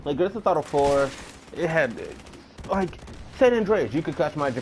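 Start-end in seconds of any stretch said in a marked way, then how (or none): none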